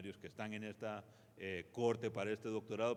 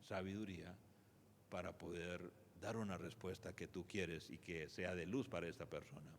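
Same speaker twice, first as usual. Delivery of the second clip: softer, lower, measured inner prayer; oración mental; 3 semitones lower, 7 dB quieter than usual. Retold or imitated. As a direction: quietly imitate praying in your head. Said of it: "Y en mi mente es". (oración interior en voz baja)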